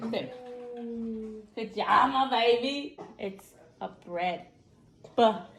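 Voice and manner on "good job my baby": tender praise. Real, not acted. Mom praising her child.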